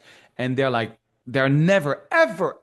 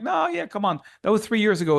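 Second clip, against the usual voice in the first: dismissive brush-off; 7 semitones higher than usual. Retold or imitated. Partners brush off his protest.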